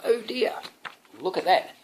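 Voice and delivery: usual speaking voice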